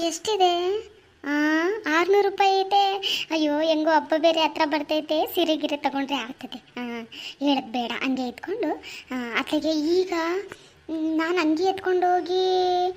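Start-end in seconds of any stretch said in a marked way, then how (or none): none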